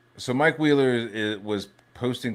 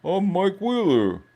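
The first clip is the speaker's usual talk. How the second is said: higher, louder taunting mock voice; 8 semitones higher, 3 dB louder than usual. Streamer mocking Mike Wheeler taunting everyone, higher and louder. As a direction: sneer it like a gloating troll. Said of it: "be like".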